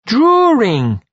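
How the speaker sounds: put-on high, loud toddler yell; drawn-out, high-pitched little kid shout; child's yell. A narrator's reading. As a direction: squeal it out like an excited three-year-old.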